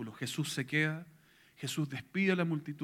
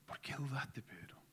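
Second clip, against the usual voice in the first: whispered, much softer, fading to nothing; intimate whisper; 11 dB quieter than usual. Acted. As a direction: lean in and breathe the question to Peter.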